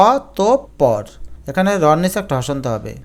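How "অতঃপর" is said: অতঃপর broken as "aw... top... por"; syllable by syllable. Shown as a demonstration. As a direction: separate each syllable of অতঃপর. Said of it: "এটার উচ্চারণ হচ্ছে অতঃপর". (অতঃপর, ভেঙে ভেঙে)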